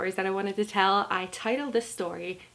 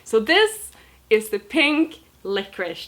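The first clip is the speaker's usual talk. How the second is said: ordinary delivery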